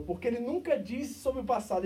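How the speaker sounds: ordinary delivery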